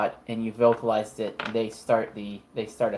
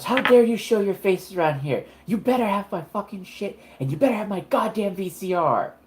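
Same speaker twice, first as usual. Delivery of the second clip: higher-pitched furious tirade; Carmen yelling; 8 semitones higher than usual. Acted. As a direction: lay into the thief who robbed her. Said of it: "she starts yelling at him".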